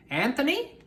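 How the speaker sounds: lightly voiced old witch; Stregonona.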